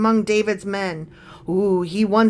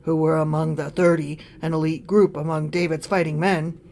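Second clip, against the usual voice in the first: lower, softer aside voice; parentheses voice; 7 semitones lower, 6 dB quieter than usual. Acted. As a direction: drop down as if in brackets. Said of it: "parentheses voice".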